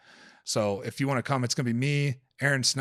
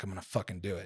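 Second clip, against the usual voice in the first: hushed resolve; 5 semitones lower, 9 dB quieter, faster than usual. Retold or imitated. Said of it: "I'm like".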